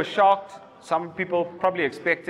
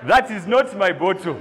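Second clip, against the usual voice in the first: normal talking voice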